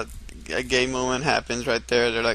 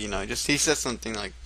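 ordinary delivery

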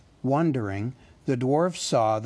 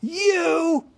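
much higher, louder enraged shout; furious cry; 5 dB louder than usual. A narrator's reading. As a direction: explode at the hobbit. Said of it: "cried Thorin".

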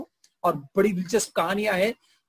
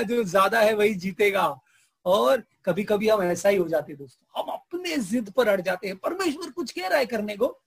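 ordinary delivery

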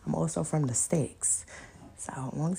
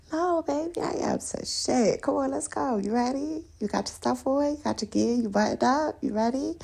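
high, sing-song checklist of questions; sweetly mischievous; 7 semitones higher than usual. Pretend. Fussing over the guy's ski gear, sweet and scheming. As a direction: sound innocent while plotting. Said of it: "he gonna know she up to something".

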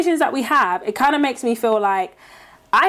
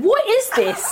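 mock baffled; 9 semitones higher, slower than usual. Acting baffled at YouTuber question.

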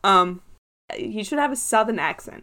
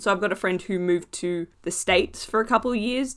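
normal talking voice

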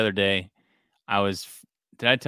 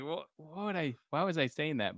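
softer, higher self-questioning; inner voice; 6 semitones higher, 8 dB quieter than usual. Retold or imitated. Dad asking himself why he said that, softly. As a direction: mutter to yourself in disbelief. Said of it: "in my head, I'm like".

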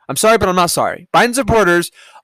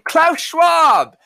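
triumphant shout; 10 semitones higher than usual. Real, not acted. Finally remembering the name.

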